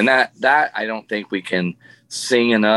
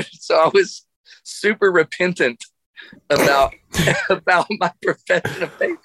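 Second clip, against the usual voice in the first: laughing; 8 semitones higher than usual. Real, not acted. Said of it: "laughing through it".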